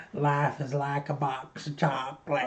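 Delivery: purposely bad, soft Forrest Gump imitation; hammed-up Southern Gump line. Shown as a demonstration. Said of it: "supposed to be purposely bad".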